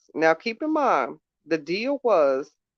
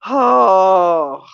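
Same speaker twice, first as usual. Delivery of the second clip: exasperated cry; 9 dB louder than usual. Real, not acted.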